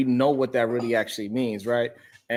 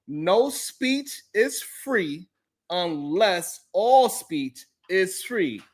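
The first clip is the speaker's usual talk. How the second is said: drawn-out reading; 5 semitones higher, much slower than usual. Acted. Reading super chat slowly.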